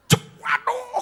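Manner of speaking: very high mock "waduh"; yelp of pain. Man hit by Indonesian archer's arrow, high mock yelp. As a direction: cry out high as if struck. Acts out victim struck by arrow.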